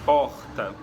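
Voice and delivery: "porta" with a guttural rasping R, stressed loud; carioca R demo. Shown as a demonstration. Teacher demonstrating the carioca R in "porta". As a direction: say "porta" rolling the R in the throat like a Rio speaker. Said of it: "eles geralmente falariam".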